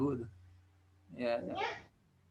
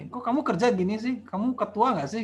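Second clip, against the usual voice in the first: higher-pitched challenge to a superior; acted protest; 5 semitones higher than usual. Teteh protesting to a superior at work, indignant mock complaint. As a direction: protest to the boss in a raised pitch. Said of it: "sering protes ke atasannya".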